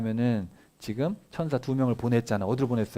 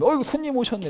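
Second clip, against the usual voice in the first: much higher, delighted exclamation; surprised welcome; 12 semitones higher, 4 dB louder than usual. Acted. Abraham surprised to see guests arrive, high delighted greeting. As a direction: greet the guests with a startled lift.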